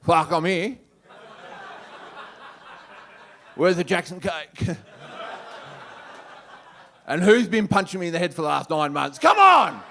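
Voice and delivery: put-on loud, brash bogan baby voice; hammed-up bogan newborn. Newborn bogan announcing its arrival, brash loud yell. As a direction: play a crude newborn yelling.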